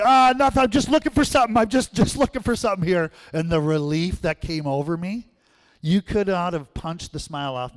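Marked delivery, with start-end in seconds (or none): 0.0-3.1 high, loud nervous cover-up; caught-out excuse. Retold or imitated. Boy caught crying covers up to his brother, high and nervous. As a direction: brush it off fast like nothing happened. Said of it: "I'm like".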